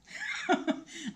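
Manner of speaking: high burst of laughter. Real, not acted. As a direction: laugh at your own confession. Laughs at own baby wish.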